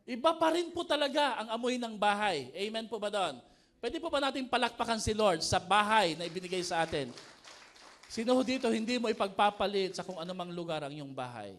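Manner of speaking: loud, rousing preacher's call; rally the congregation, voice up; preaching at full force. Real, not acted. Pastor rousing the congregation to applaud, loud preaching.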